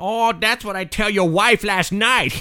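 rude foreign guest in broken English; broken-English foreign character. Acted. Comedy guest mocking the host, broken-English accent. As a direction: insult the host in a thick put-on accent.

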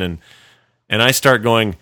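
ordinary delivery

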